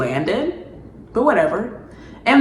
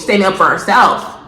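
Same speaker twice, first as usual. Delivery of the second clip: ordinary talk, nothing marked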